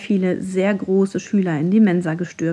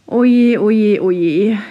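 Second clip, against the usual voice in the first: dismayed professor; 7 dB louder, much slower than usual. A narrator's reading. Professor lamenting to the shrunken class, loud dismay. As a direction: wail it like a rattled old scholar.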